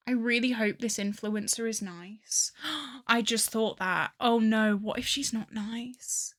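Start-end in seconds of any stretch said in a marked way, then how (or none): 2.6-3.0 sharp quiet intake of breath; soft startled gasp. Real, not acted. Sudden worried thought. 4.8-6.3 slow unvoiced fretful whisper; worried whisper. Real, not acted.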